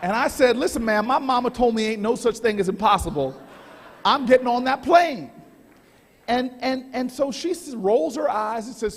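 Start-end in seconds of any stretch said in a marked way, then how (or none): 0.6-5.3 loud, hand-on-hip sass; sassy mama. Retold or imitated. Traveler to gate agent, loud sassy mama attitude. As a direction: channel your mama and lay it down loud. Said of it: "give her a dose of my mama".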